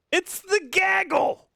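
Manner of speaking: high, gleeful call of gaggle; excited shout. Real, not acted. Shouting to greet the incoming raid, high and gleeful. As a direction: hail the arriving crowd.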